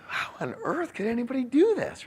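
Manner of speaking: high-pitched mock disbelief; incredulous inner thought. Husband thinking to himself at bag mound, incredulous. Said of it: "I'm thinking to myself".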